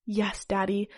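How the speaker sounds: usual speaking voice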